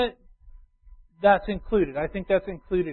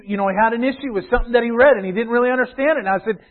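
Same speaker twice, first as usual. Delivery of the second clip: ordinary delivery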